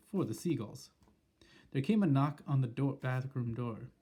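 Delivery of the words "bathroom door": ordinary delivery, nothing marked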